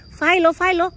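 calling out to the cat. Real, not acted. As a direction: owner calls her cat Philo twice, loud and high.

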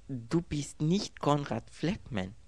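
slow, high-pitched disbelief; disbelieving. A narrator's reading. Girl stares at the stranger, asking in disbelief. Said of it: "Ungläubig starrte sie ihn an".

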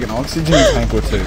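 ordinary talk, nothing marked

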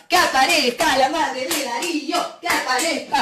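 sing-song pretend appeal; mock summoning call. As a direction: call out for a missing person. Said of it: "Ahí la llamamos".

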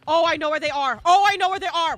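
rising excited yell; excited shout. Real, not acted. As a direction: call it out loud to the team. Spotting survivors, repeats louder.

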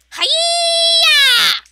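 a sudden high, loud battle yell; high karate cry. Real, not acted. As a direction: shriek it like a karate chop. Attack cry while chopping.

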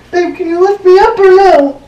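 real high-pitched, whiny pleading; high strained plea. Real, not acted. Struggling in partner yoga pose.

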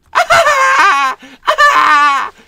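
loud high villain cackle; evil cackle. Acted. Doctor cackles at his begging assistant, loud and high. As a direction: laugh cruelly.

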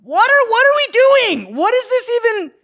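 high, loud bewildered cry; shocked disbelief. Real, not acted. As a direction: react in disgusted disbelief. Disgusted shock at clip.

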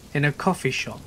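plain reading voice

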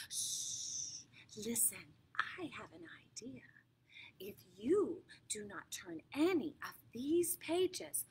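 unvoiced conspiratorial whisper; hushed whisper to the reader. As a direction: lean in and whisper a secret plan.

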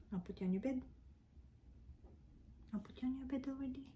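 ordinary delivery

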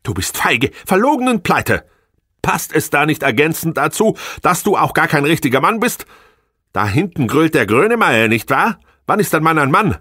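high, contemptuous scolding woman; scornful wife. Acted. Angry wife berating her failing husband, high and scornful. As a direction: lash him with every reproach.

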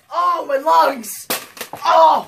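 gasping dying soldier, hammed-up; wounded soldier gasping. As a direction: clutch your chest and gasp out loud. Shot, dramatic dying gasp.

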